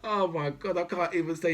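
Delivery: ordinary talk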